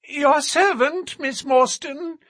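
high, thin nervous little man. A narrator's reading. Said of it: "in a thin, high voice".

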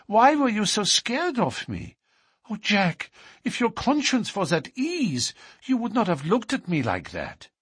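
put-on anxious young woman's voice; Etty. A narrator's reading. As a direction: press him, anxious and hurt. Etty pleads with her lover.

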